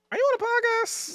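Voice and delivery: very high-pitched curious question; imitated school principal.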